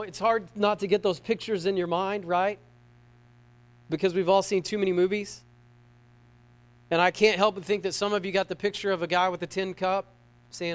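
ordinary delivery

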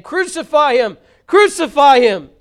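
loud, high, falling cries of the mob; crowd shout. A narrator's reading. The mob crying out to Pilate, a loud shout. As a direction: yell it out as an angry crowd. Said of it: "they cried out".

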